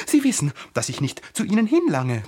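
high, loud, worked-up flower; indignant, high-voiced outburst; agitated panting. A narrator's reading. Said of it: "keuchte sie".

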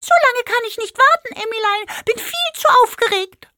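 very high, loud squeaky pig voice; pig creature voice. A narrator's reading. Pink pig cheerfully answers Emmy, very high and bright. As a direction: chirp it out like an eager little magic pig.

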